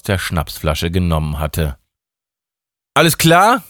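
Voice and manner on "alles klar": high, loud question; a quick check-in; Mike asking. A narrator's reading. Mike asks Emma if she is fine, high and loud. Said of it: "fragte Mike".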